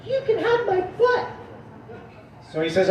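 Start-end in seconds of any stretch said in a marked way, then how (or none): 0.0-1.3 put-on little-girl nun. Nun bargaining with supposed God, high girlish voice. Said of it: "She sounds a lot like the little girl".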